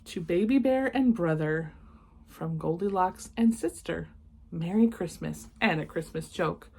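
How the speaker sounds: ordinary delivery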